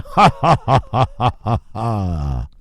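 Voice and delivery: gloating fake laughter; mock laugh. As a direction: laugh like a greedy banker. Mock laugh of bailed-out bankers.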